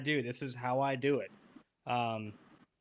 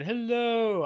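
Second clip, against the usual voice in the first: Seinfeld-style hello; 10 semitones higher, 7 dB louder than usual. Retold or imitated. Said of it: "all I thought was Seinfeld".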